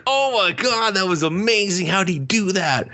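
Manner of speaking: high, gushing awe; mock amazement. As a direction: gush like it's the best trick ever. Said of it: "I was like".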